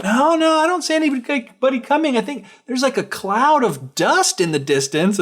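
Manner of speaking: high-pitched lookout's reply; sister calling back. Retold or imitated. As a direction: imitate the sister reporting from afar. Sister calls back from tower.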